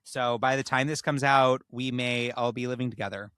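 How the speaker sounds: usual speaking voice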